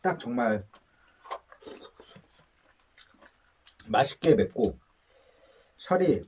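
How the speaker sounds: chewing while talking; eating spicy chicken; mouth full. Real, not acted. Seen 3: Likely speaker (man) eats chicken, chewing between words throughout.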